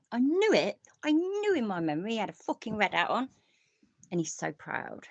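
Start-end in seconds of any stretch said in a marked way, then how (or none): 0.1-3.3 delighted. Real, not acted. Delighted memory proven right.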